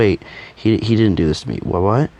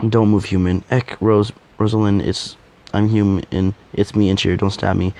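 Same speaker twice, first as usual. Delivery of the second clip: ordinary talk, nothing marked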